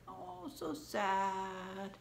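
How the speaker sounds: mock sad. Teacher mirrors sad faces.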